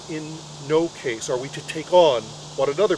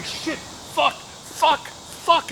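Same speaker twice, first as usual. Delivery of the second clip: desperate cursing; 10 semitones higher, much slower than usual. Retold or imitated. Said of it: "he's crying".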